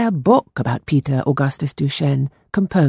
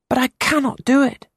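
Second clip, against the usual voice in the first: put-on higher, earnest young boy voice; 10 semitones higher than usual. A narrator's reading. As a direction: play the anxious ten-year-old boy. Boy Peter voiced in story.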